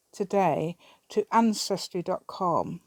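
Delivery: normal talking voice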